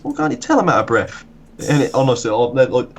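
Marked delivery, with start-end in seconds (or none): 0.0-1.2 put-on much higher, cheeky kid excuse. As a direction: whine it out innocently. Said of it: "He goes".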